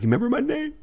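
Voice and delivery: very high, tiny voice; comic falsetto disbelief; starstruck squeak. Retold or imitated. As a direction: squeal it like an awed fan. Starstruck reply acted out.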